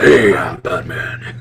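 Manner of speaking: slow, low mock-gravel Batman; old Batman doing new Batman; Adam West doing Bale. Shown as a demonstration. Retelling Adam West mocking Bale's Batman. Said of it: "old Batman doing new Batman".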